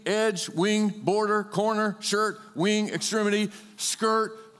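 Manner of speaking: ordinary talk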